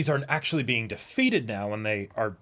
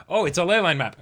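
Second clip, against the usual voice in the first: loud, high mock discovery; hammed-up realization, higher and louder; 6 semitones higher, 5 dB louder than usual. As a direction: act out the moment it clicks, raised and bright.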